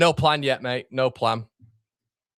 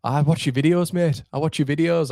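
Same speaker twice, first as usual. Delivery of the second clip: higher-pitched, badly faked Mackem voice; terrible Mackem accent; 5 semitones higher, 3 dB louder than usual. Subscriber telling the host he's a fan, terrible Mackem accent. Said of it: "Terrible Macam accent".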